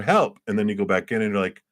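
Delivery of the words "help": high, playful yelp for help; half-hearted, high-pitched distress call; mock cry for help. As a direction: pop out with a pretend cry for help. Acts out calling from the cocoon.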